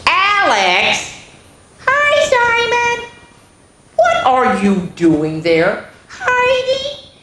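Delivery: put-on very high squeaky child voices; surprised greeting in the dark; startled boys. Boys meet under bed.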